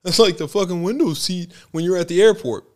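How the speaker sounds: hammed-up impression of a rowdy fan; mocking fan. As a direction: imitate the fan's loud excuse in a put-on voice. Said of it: "He's like".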